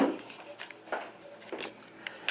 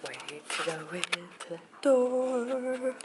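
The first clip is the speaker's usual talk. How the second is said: ordinary talk, nothing marked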